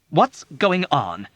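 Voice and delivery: firm slow demand. A narrator's reading. Nurse Duckett demanding to know what's wrong. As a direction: demand an answer. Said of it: "she demanded".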